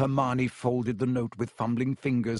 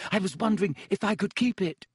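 high, breathless schoolgirl request; 8 semitones higher than usual. A narrator's reading. Schoolgirl begs librarian to keep autograph, breathlessly. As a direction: ask with fluttery hopefulness. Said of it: "she said breathlessly".

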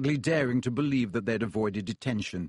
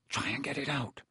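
much softer, hushed urging; whispered; 8 dB quieter than usual. Acted. Ron urging Harry at Hermione's bed, hushed. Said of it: "Ron whispered".

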